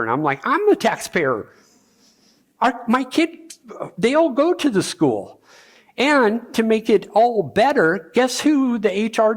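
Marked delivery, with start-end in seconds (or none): 0.5-5.4 raised, insistent protest; higher, heated taxpayer's objection; indignant retort. Retold or imitated. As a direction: push back hard, voice climbing. Arguing with the school district.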